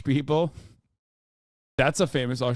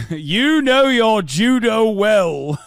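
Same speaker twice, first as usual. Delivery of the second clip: loud, slow, higher mock heroics; hammed-up movie line; 5 semitones higher, 10 dB louder, much slower than usual. Streamer quoting a film line, loud and slow. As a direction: ham it up like a bad action film. Mock quote after laughing.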